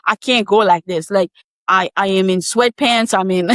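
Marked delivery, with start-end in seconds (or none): none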